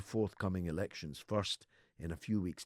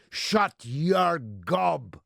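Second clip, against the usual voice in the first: very slow, loud, high quoted order; retold shouting; 7 semitones higher, 10 dB louder, much slower than usual. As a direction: bellow each word of the quote slowly. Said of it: "They're shouting at Jeremy Corbyn".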